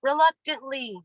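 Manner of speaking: a director calling out from behind the camera; called out direction. Acted. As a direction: call the word out across the set. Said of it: "I call out".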